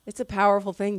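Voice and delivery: usual speaking voice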